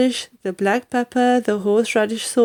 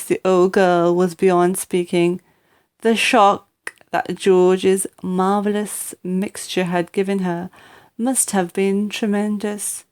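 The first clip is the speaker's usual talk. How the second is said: usual speaking voice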